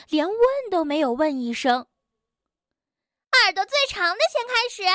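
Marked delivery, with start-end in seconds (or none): 3.4-4.8 very high, fast chattering busybody; pushy squeaky rabbit. Acted. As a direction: chatter eagerly, a little too forward. Suqi character voice.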